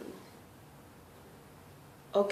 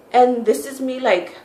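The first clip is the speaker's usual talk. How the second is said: usual speaking voice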